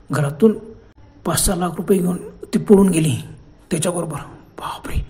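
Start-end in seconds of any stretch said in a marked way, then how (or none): 4.6-5.0 breathless whispered बापरे; whispered shock at hearing of the theft; whispered gasp. A narrator's reading. Listener gasps at shocking news.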